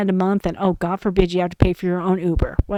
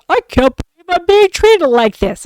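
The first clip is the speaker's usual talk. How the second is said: high put-on voice, indignant and self-pitying; mocking entitled coworker; 7 semitones higher, 4 dB louder than usual. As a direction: play his wounded high-horse whine. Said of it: "kept getting on his high horse".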